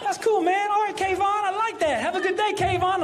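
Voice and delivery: high-pitched approving TSA worker; voice going up. Retold or imitated. TSA worker sending a passenger off, high-pitched and pleased. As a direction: keep it up in a squeaky register. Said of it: "his voice went up a little bit".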